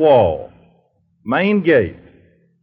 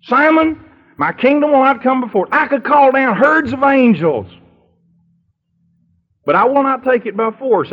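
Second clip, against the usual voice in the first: Jesus rebuking Simon; 5 semitones higher than usual. Acted. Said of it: "And rebukes Simon".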